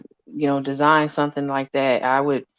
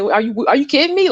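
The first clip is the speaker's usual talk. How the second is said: raised, higher pitched disbelief at mom; retold yelling; 9 semitones higher, 3 dB louder than usual. Telling off mom about the virus, mock yell. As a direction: relive yelling at your mother, half-shouted. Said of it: "I was yelling at her".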